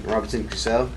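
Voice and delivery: normal talking voice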